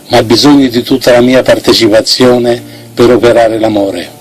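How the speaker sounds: ordinary delivery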